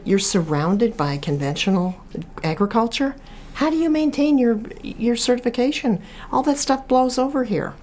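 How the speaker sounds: a retold, stunned how-is-that-possible; high, amazed questioning; incredulous question. Her incredulous question to the vintner.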